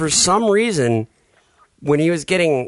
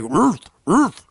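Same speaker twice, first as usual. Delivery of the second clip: higher mock dog woofs; imitated dog bark; 5 semitones higher than usual. Shown as a demonstration. Asking how the kid barked, imitated dog woofs. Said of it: "or would he be like".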